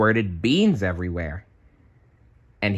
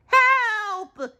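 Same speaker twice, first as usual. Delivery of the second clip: very high squeaky cry for help; SpongeBob crying help. A narrator's reading. SpongeBob being torn apart by managers, yelping high. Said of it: "SpongeBob cried".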